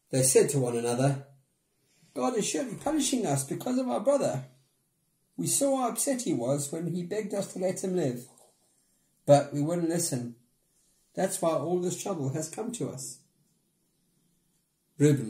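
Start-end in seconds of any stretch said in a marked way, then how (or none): 2.1-13.2 higher, worried brothers voice; lightly voiced guilty brothers; brothers' voice. A narrator's reading.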